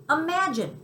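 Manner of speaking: high, loud wondering exclaim. Acted. Tucker reacts to Chester trapped in the basket, a high exclaim. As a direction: caught up in the tale. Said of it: "Tucker exclaimed".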